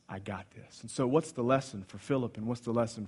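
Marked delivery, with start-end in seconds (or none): 0.1-0.8 calm reassurance. Acted. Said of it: "he says".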